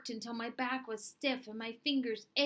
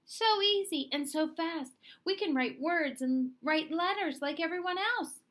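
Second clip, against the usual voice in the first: higher, louder eager chatter; excited students; 7 semitones higher, 4 dB louder than usual. Acted. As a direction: gush with delight. Students excited by new code.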